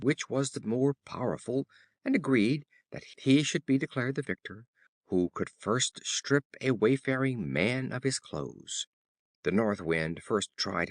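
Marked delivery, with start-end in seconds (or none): none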